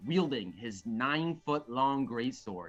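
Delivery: dramatic bardic tale-telling; storytelling narration. Acted. Reading the play's opening fight to friends, dramatic narration. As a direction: narrate an epic battle tale, weighing each phrase.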